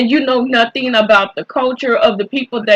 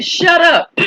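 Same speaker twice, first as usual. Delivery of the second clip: high, sharp mock cop snap; a cop snapping at the driver to shut up, high mock bark; 7 semitones higher than usual. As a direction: bark it like a rude officer. Cop telling her to shut up.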